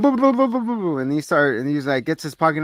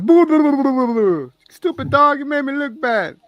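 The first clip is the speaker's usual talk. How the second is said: high mock spooky boo; 12 semitones higher, 7 dB louder than usual. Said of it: "And then he goes".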